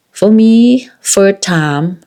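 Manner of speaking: emphatic. Real, not acted. Recalling shock.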